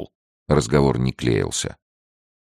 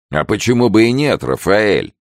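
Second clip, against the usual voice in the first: loud, higher comeback; parry it with raised voice; challenging retort; 9 semitones higher, 5 dB louder than usual. Acted. Pedro counters his fellow gendarme, challenging retort. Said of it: "парировал Педро Зурита".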